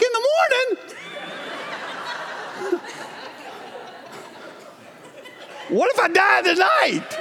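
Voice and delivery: very high, slow disbelief with laughter; incredulous. Retold or imitated. To the heart doctor, laughing and disbelieving.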